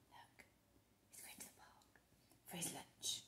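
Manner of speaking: hushed aside to the listener; whisper. Real, not acted. Reader whispering to the child about Mister Brown. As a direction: lean in and whisper.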